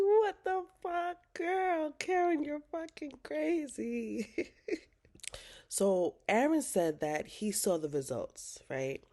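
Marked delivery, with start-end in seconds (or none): 0.0-4.5 much higher, louder, slowed, half-crying disbelief; shocked outburst. Real, not acted. Vlogger reacting to the father reveal, shocked shriek. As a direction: scream it in disbelief at Karen. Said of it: "I'm like".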